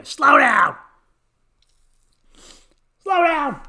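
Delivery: high, loud mock scream; imitated angry-bystander yell. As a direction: scream it uselessly after a speeding car. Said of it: "the person who screams".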